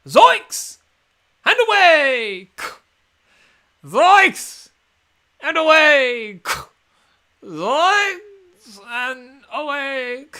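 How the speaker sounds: high, loud cartoon duck yelling; Daffy Duck.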